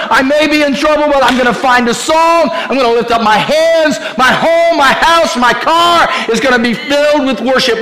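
high loud shouted praise; shouting praise. Acted. Preacher shouting praise for the congregation, high and loud. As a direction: lift the roof with worship. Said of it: "I'm gonna shout".

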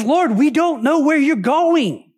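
acted anguish, drawing the words out; hurt disciple. Thomas pleads with Jesus who is leaving, hurt and plaintive. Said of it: "Can you hear it in his voice?".

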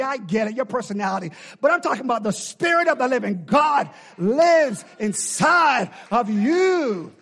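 loud, high shouted exhortation; full-force preaching. Real, not acted. Preacher exhorts the congregation to have joy, shouting. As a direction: rouse the room to have joy.

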